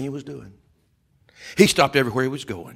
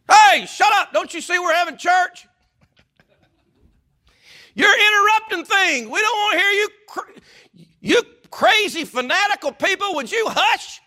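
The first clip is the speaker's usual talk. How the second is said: high, loud rebuking shout; kalabalığın azarı; 6 dB louder than usual. Acted. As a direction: shout them down like an indignant churchgoer. Acting the crowd rebuking blind men.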